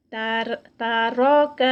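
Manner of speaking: تارک drawn out very slowly, light ta, heavy ra, light kaf; slow recitation. Shown as a demonstration. Teacher slowly reciting تارک for students. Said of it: "heavy درمیان میں".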